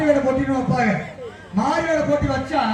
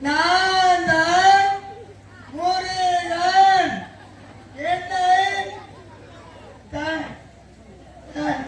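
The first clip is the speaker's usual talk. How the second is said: put-on higher, very slow, singsong toddler recital; little child; 5 semitones higher, much slower than usual. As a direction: drag it out like a tiny child on stage. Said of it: "அதுக்கு பேசி பாருங்க".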